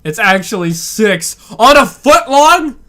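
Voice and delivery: high, loud indignant correction; riled up. Real, not acted. Correcting the olive count, riled up and loud. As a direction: get worked up over the olives. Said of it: "Sorry for getting riled up".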